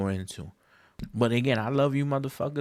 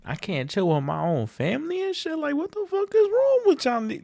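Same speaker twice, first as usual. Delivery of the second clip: much higher, rising to a loud cry; outraged disbelief; 4 dB louder than usual. Retold or imitated. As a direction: build into a loud incredulous yell. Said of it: "And I'm like".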